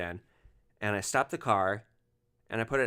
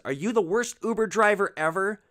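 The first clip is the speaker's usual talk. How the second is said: higher and louder sneering jab; mock the driver with contempt; scornful insult; 9 semitones higher, 6 dB louder than usual. Passenger taunting the driver, scornful and loud.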